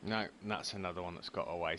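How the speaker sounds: ordinary talk, nothing marked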